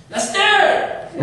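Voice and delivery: high, loud drawn-out yell for his son; dad calling out. Retold or imitated. Strict dad yelling for his son upstairs, loud drawn-out call. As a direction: holler the name across the house, voice way up. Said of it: "pray that I wouldn't hear".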